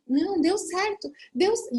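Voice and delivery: high-pitched, overwhelmed insistence; imitating tearful husband. Husband insisting to disbelieving wife, imitated crying. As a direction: retell it as a tearful, cut-off exclamation. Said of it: "como ele chorava".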